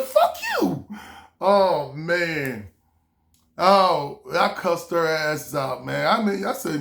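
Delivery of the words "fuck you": very high mock squeal; hammed-up high-pitched retort; retold angry comeback. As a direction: squeal the curse straight back. Acts out his cursing back.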